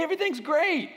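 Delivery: high-pitched fake cheerfulness; passive-aggressive cheer. Upset person pretending all is well, falsely cheerful. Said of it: "when it's not great".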